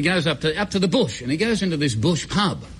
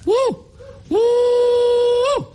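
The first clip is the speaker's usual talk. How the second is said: high, loud hooting yell; bunyip mating call; 4 dB louder than usual. Retold or imitated. Old bloke repeating the mating call outside the cave, loud high hoot. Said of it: "You, you call again".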